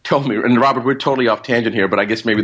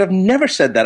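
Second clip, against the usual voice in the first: higher-pitched, emphatic retold denial; insistent denial; 7 semitones higher than usual. Doctor pressed under oath by attorney, insistent retold denial. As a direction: deny it more forcefully, as a quote.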